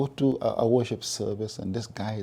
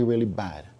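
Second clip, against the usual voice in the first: ordinary delivery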